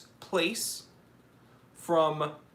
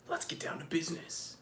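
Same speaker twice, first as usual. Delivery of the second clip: whisper; 11 dB quieter than usual. Real, not acted. Streamer mutters to himself.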